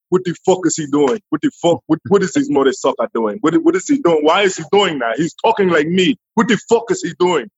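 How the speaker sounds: mock African accent, indignant and repetitive; Michael Blackson. Comedian mimics his idol griping backstage, indignant African accent. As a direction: put on an African accent, baffled and offended. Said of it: "he was in the back like".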